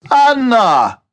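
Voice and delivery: loud call across the lounge; shouting. Acted. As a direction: shout her name to get attention. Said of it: "Ricky shouted".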